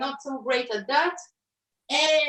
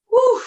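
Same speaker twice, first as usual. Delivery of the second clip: relieved whew; 12 semitones higher, 9 dB louder than usual. Real, not acted. After hard set.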